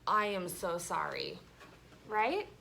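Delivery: ordinary talk, nothing marked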